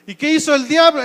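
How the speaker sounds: usual speaking voice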